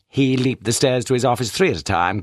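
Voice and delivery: usual speaking voice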